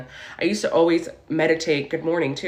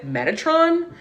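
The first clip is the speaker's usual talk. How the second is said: mocking husband; 11 semitones higher, slower than usual. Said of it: "he starts laughing at me".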